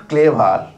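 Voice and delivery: "ক্লেভার" with both syllables sounded out; rejected pronunciation. Shown as a demonstration. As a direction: say "ক্লেভার" as klay-var, the wrong way.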